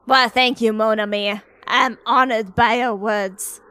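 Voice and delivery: ordinary talk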